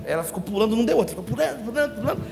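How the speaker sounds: usual speaking voice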